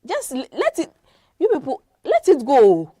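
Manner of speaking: loud, high exasperated cry; exasperated shout. Real, not acted. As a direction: throw up your hands and yell to leave them be. Exasperated outburst at the listeners.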